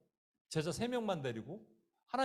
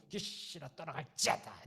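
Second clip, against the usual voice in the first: squeezed, forced exorcism command; mock all-out straining, held-down volume; through gritted teeth; 4 dB quieter than usual. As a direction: push the words out through clenched teeth. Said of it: "이빨이 꽉 물고".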